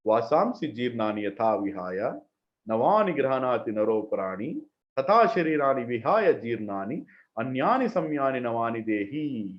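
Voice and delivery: funeral van cassette style. Retold or imitated. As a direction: recite it like the cassette played at funerals. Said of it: "I'll read the shloka in their accent".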